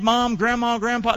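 ordinary delivery

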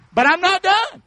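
high, loud preached declaration; rising preach. Real, not acted. Preacher telling the church he isn't finished, loud and high. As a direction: push on, fired up.

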